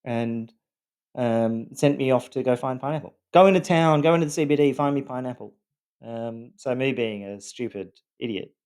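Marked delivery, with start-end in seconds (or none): 3.3-5.5 demanding wife. Retold or imitated. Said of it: "and she demanded".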